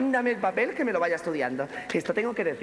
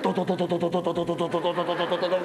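low, rapid rhythmic to-to-to; helicopter chop; 5 semitones lower than usual. Acted. Comedienne imitates the helicopter over the stage, steady low chopping. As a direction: chop out a helicopter rotor on one low note.

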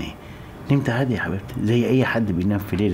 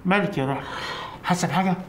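concerned surprise; 9 semitones higher than usual. Acted. Husband notices she is dizzy.

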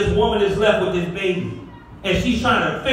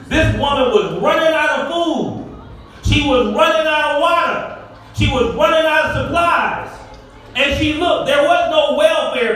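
building preaching; 4 semitones higher than usual. Acted. Preacher lifts the church, preaching at full force.

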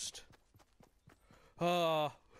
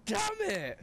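high, loud frustrated cry; frustrated shout; 3 dB louder than usual. Real, not acted. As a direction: yell it in frustration. Lost the final fight.

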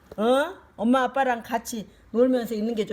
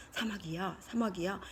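soft coaxing call; 4 semitones lower, 11 dB quieter than usual. Acted. Elephant softly calls the mantis.

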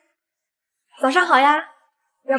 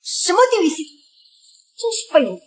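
contemptuous outburst; angry; 6 semitones higher than usual. Acted. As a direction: spit it out in scorn. Cursing the broken system.

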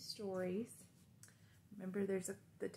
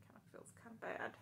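fast whispered aside; 2 semitones higher, 11 dB quieter, faster than usual. Real, not acted. Teacher whispers an aside to the children. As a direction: whisper it quickly.

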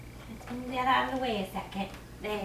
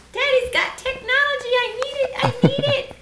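excited; 12 semitones higher, 6 dB louder than usual. Real, not acted. Playful teasing about phone.

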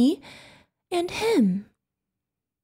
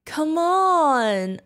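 usual speaking voice

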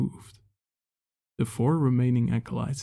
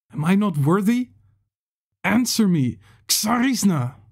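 much higher and louder, raging shout; angry shout; 8 semitones higher, 8 dB louder than usual. A narrator's reading. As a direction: rage at the goddess at full voice. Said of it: "he shouted angrily".

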